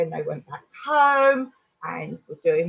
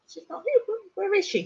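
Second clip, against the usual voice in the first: higher, a touch of panic in the imitated mum; alarmed mum; 10 semitones higher, faster than usual. Mum realises her daughter isn't home, rising alarm. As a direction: play the mum suddenly worried.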